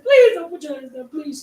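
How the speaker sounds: high, loud, pleading cry; desperate begging. Retold or imitated. Woman on video begging the young man, crying plea. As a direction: beg him through tears not to move. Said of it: "she was just crying".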